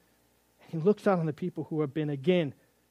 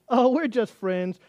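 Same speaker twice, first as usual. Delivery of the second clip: higher, slow, louder airy friend-zoning reply; girl's voice; 5 semitones higher, 5 dB louder, slower than usual. Retold or imitated. Girl tells boyfriend on TV they're just friends, mock higher voice. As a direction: let him down breezily, drawn out.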